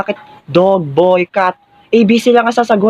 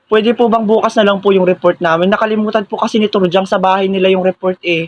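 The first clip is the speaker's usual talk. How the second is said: usual speaking voice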